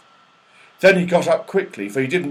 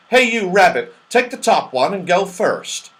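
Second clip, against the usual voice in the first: high, loud call to the rabbit; bossing the rabbit to go first; Moonface calling out; 6 semitones higher, 5 dB louder than usual. Acted. Moonface ordering the rabbit onto the slide, high and loud. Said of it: "he said".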